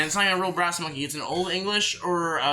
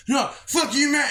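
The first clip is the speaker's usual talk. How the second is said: higher-pitched mock angry retort; belligerent drunk; 7 semitones higher, slower than usual. Drunk James being riffed on by friends, imitated angry comeback. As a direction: imitate a drunk lashing out at teasing. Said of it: "getting belligerently fucking drunk as fuck".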